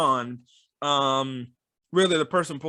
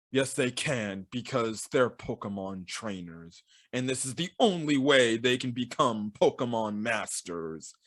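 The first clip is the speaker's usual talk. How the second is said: lower, softer grave pronouncement; a hint of a solemn mentor; solemn Russian lady; 5 semitones lower, 5 dB quieter than usual. Russian lady explaining the trainers' path, low and grave. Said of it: "the Russian lady is like".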